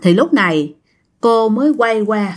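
ordinary delivery

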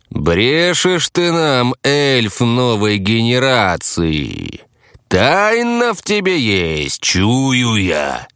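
slow, drawn-out ominous dark lord; sinister voice. A narrator's reading. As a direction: stretch each word out menacingly. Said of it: "зловещим голосом протянул я".

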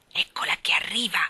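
urgent hissed whisper; Mary Poppins warning the lark in a hiss. Acted. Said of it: "sibilò Mary Poppins".

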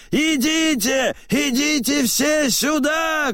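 high, urgent summons; high-pitched crowd call; shouting. A narrator's reading. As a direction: call everyone over at the top of the pitch range. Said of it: "кричит".

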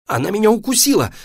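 high, loud, fast yelp; pained, startled shout; crying out. A narrator's reading. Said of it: "закричала мама".

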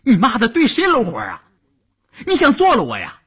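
high-pitched startled scolding; angry cursing. Acted. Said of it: "大骂道". (高声大骂)

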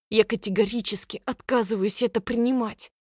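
plain reading voice

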